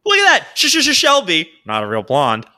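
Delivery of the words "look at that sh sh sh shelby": loud, high-pitched exclamation; high excited shout to someone nearby; excited call-out. Real, not acted. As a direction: call out in amazed triumph, voice shooting up. Calling out to Shelby who spotted it.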